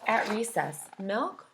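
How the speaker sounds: plain reading voice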